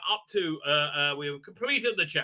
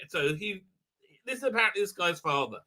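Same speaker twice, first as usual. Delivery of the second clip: ordinary delivery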